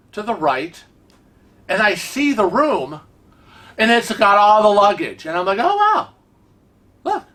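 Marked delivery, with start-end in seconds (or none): 5.6-7.2 high-pitched, wowed exclamation; amazed reaction. Retold or imitated. Acting out delighted first sight.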